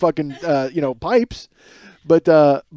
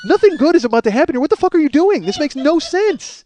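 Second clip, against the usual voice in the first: panicked inner voice; 11 semitones higher, 4 dB louder than usual. Retold or imitated. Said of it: "it dawns on me".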